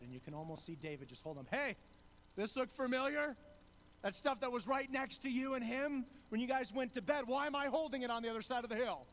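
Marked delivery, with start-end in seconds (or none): 1.5-9.1 higher and louder, calling across the hill; taunting call. Retold or imitated. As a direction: hold up the spear and call out, loud and pointed. David taunts Abner holding evidence.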